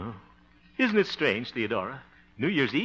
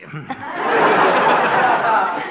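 loud high chuckle; hearty laugh; 10 semitones higher, 11 dB louder than usual. Acted. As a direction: laugh at your own clumsy story. Harold laughs at his story.

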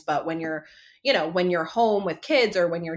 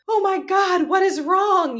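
put-on high, loud alarmed child voice; alarmed kids; 13 semitones higher, 7 dB louder than usual. Kids shocked at crying mom, high and loud. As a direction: gasp it like a startled kid.

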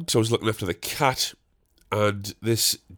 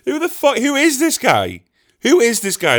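high-pitched, exasperated disbelief; mock incredulous; 13 semitones higher, 5 dB louder than usual. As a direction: squeal it in baffled self-mockery. Mock disbelief at his own persona.

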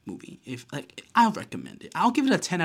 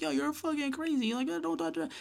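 high-pitched imitation of a protesting girl; squeaky, softer mock outrage; 4 dB quieter than usual. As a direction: put on a very high girly voice. Retold other girl protesting.

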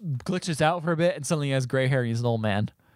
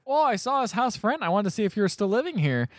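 higher-pitched friend impression; chirpy visiting friend; 8 semitones higher than usual. Best friend knocking on the door, chirpy. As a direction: imitate a friend dropping in, pitched up.

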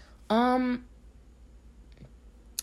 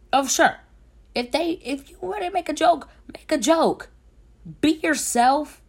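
much higher, louder mock-cheery voice; sarcastic go-ahead; 12 semitones higher, 6 dB louder than usual. Mocking guys who want to joke, sarcastic high voice.

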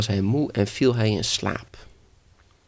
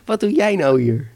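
high, loud demanding question; startled grandmother; 9 semitones higher, 6 dB louder than usual. Acted. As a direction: challenge the unexpected visitor. Grandmother confronts boy in hell. (verbaasde, bitse grootmoedervraag)

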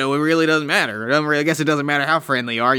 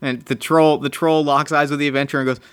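normal talking voice

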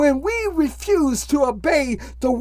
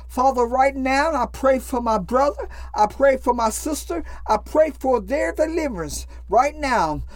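ordinary delivery

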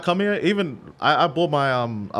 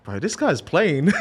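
laughing disbelief; 4 semitones higher, slower than usual. Retold or imitated. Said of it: "I was like".

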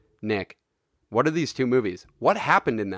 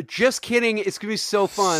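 suddenly cheery; 8 semitones higher than usual. Real, not acted. Mock switch to cheerful.

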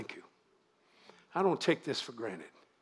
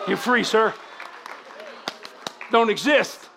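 loud, raised deliverance cry; forceful proclamation; 7 semitones higher, 7 dB louder, slower than usual. Real, not acted. Preacher declaring a man free, loud and commanding. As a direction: call it out with full authority.

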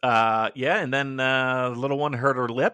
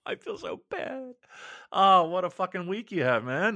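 laughing, much higher; laughing sympathy; 6 semitones higher than usual. Real, not acted. Friend hearing of a toddler's stitches, laughing.

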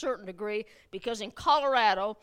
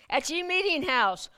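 higher, louder mock olde English; hammed-up pilgrim voice; 5 semitones higher, 4 dB louder than usual. Shown as a demonstration. Pastor repeating the phrase in mock pilgrim voice. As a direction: draw out the archaic line. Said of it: "This is the way they talked".

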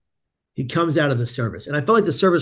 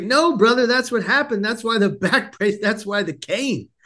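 higher-pitched, imitated insisting to a doubter; insistent protest; 7 semitones higher than usual. Patient to the disbelieving chaplain about the jump, insistent.

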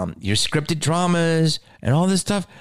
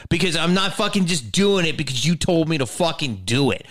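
ordinary delivery

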